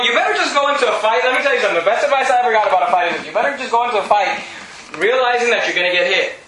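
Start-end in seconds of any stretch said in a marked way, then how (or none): none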